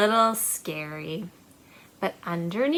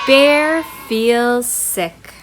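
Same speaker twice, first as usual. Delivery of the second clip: announced book title; 9 dB louder, much slower than usual. Acted.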